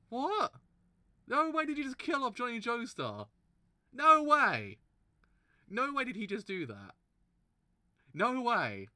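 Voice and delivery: loud, high cries of disbelief; real shock, shouting no way; shocked outburst. Real, not acted. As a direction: yell it in pure disbelief. Shocked at character's death.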